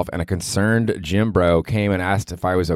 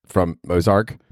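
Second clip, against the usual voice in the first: normal talking voice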